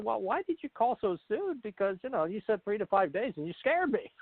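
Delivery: high-pitched mock protest; half-joking reproach, voice climbing high; retold complaint. Retelling his complaint to the caller.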